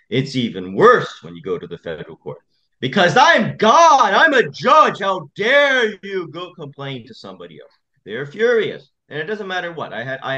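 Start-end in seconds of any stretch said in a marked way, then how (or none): 2.8-7.7 mock arrogant judge. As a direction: play the self-important judge who thinks he's God. Mocking the arrogant, outraged judge.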